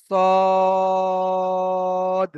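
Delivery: সদ held long and thick; heavy drawn swad. Shown as a demonstration. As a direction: press সদ out slow and heavy. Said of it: "তো এখানে উচ্চারণ হবে". (মোটা করে টেনে সদ)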